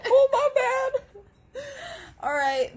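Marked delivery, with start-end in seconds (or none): 0.1-1.6 high slow laughing puppy voice. Pretend. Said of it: "She's like".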